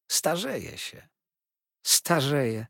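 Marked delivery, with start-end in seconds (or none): 1.9-2.7 put-on young wife echoing her aunt in surprise, exclaiming; astonished exclamation. A narrator's reading. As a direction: echo the word higher and louder, amazed. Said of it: "zawołała Dora".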